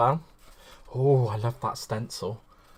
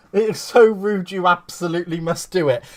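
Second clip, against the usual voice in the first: a higher, insistent imitation; Michelle's voice; 4 semitones higher, 8 dB louder than usual. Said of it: "read that in her voice".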